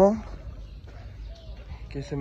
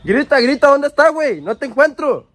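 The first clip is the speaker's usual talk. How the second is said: loud, high, insistent yelling; searching shout; 11 semitones higher, 11 dB louder than usual. Real, not acted. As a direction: shout for an answer from someone hidden. Searching for hidden friends by shouting. (gritos insistentes de búsqueda)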